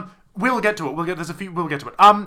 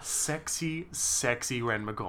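much softer, lower, swooning; mock sultry; 5 semitones lower, 11 dB quieter, slower than usual.

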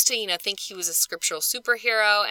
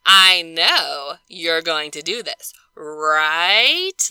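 slow, louder, pressing "right?" up; pointed expectation; 7 dB louder, much slower than usual. Retold or imitated. Paul nudging Philemon to obey, slow pointed pressure. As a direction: lean on him with a knowing push. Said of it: "or is this him, him saying".

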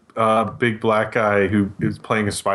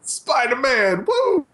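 high, stretched-out cheering yell; ad guy impression; much slower than usual. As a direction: whoop the catchphrase like the ad guy. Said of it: "He's like".

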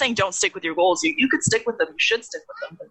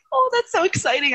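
mock excited; 11 semitones higher than usual. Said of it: "and I was like".

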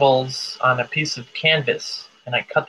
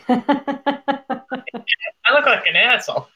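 self-mocking; 9 semitones higher, slower than usual. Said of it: "I was like".

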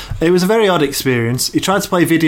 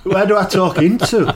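lightly imitating a lost old man, rising into a laughing high shout; mock-bewildered pensioner calling for the voice, laughing; confused old man; 6 semitones higher than usual. Old man on Zoom calling to his wife, confused and rising. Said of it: "talking to his wife in the other room, going".

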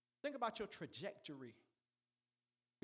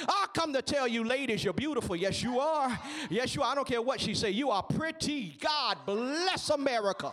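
loud, high preacher shout; preaching at full force; 5 semitones higher, 19 dB louder than usual. Acted. Preacher shouting compliments to the ladies. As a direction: whoop it out to the congregation.

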